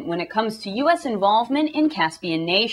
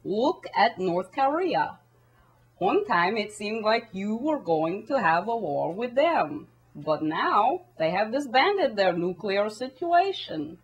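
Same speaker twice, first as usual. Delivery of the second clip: put-on soft, slow Russian-accented Putin; quiet plea in a measured Russian accent; 6 dB quieter, slower than usual. Putin sticking up for his good friend, soft Russian accent. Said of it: "good old Vladdy is like".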